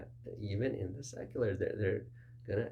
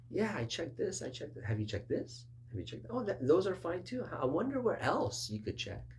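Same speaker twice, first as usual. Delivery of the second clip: musing helper; 9 semitones higher than usual. Retold or imitated. Mock helper questioning.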